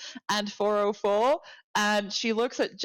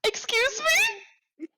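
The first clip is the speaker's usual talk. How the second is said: shocked disbelief; much slower than usual. Acted. Intern shocked by the introduction.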